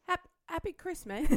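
a light put-on British accent, said high. Asked for a British accent, she tries Happy Christmas in one. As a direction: try the Brit greeting with a quick English lilt. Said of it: "Maybe if you said it in a British accent".

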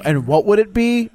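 lightly acted parent coaxing an answer; quizzing father. Father testing his daughters, leading question.

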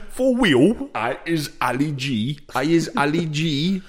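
high, slow comic impression; Ali G. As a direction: put on a drawn-out mock street-talk voice. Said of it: "who is it that does Ali G?".

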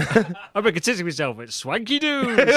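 laughing mock slogan.

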